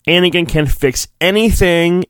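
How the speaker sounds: ordinary talk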